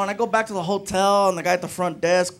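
usual speaking voice

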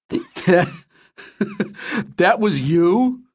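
slow, high, laughing disbelief. A narrator's reading.

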